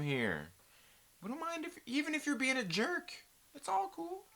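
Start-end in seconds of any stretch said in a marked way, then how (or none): 1.2-4.2 mock sweet welcome. Mock-welcoming chat visitors.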